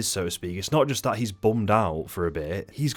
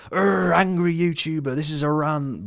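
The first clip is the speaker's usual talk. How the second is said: much higher, hammed-up ranting groan; angry YouTuber parody; 9 semitones higher, 4 dB louder than usual. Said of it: "I don't want to be a mean-spirited".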